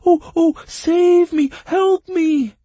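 piercing, very high, loud cry; creature's yell. A narrator's reading. Bog creature spotting an old friend, yelling. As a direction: shriek it in a tiny creature voice. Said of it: "in turn yelled the creature".

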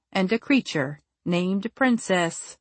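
plain reading voice

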